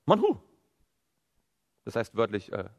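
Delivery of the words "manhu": imitated puzzled exclamation, higher and louder; erstaunter Ausruf. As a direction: call it out like people baffled by a new sight. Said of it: "Die riefen aus". (erstaunt ausgerufen)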